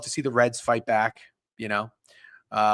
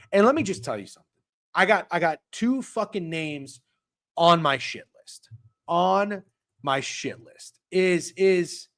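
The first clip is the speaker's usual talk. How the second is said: angry rant; 6 semitones higher, 4 dB louder, slower than usual. Real, not acted. Ranting to chat about shit list.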